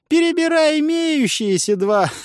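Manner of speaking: high, strange teasing voice; mocking voice. A narrator's reading. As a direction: needle him from nowhere. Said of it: "посоветовал голос".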